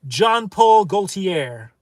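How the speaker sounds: "Jean-Paul Gaultier" said slowly with French vowels, zhahn-pole goh-tee-ay; slow, showy French "Jean-Paul Gaultier", every syllable stretched; proud French pronunciation. Shown as a demonstration. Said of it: "I said that greatly, didn't I?".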